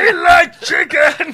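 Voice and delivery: high, slow, shouted Muppet voice. Pretend. Doing a Muppet on the chicken guy, high shouted voice. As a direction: howl it like a puppet, high and slow. Fozzie-like silly voice.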